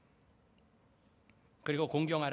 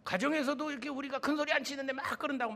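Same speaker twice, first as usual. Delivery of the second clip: high-pitched mock parental outburst; imitated angry shouting; 8 semitones higher than usual. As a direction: imitate an indignant parent raising their voice. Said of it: "막 소리를 지르고". (언성 높인 학부모 흉내)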